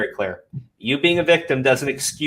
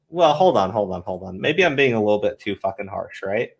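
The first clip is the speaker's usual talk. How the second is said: mock second thoughts; 5 semitones lower than usual. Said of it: "I'm just going".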